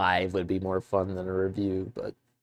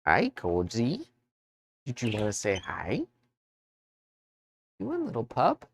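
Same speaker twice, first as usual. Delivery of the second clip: talking to a dog; 3 semitones higher than usual. Real, not acted. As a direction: coo at the puppy. Talking to his dog Koji.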